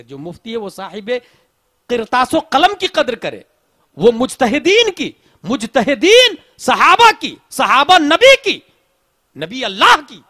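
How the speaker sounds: loud, ringing sermon, pushing each line; full-force preaching. Acted. Preacher urging listeners to greet worshippers, loud and fervent. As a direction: preach it to the back of the hall.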